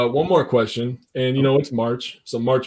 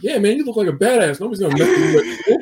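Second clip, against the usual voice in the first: laughing encouragement; 8 semitones higher than usual. Retold or imitated. Hyping up hurt stepson.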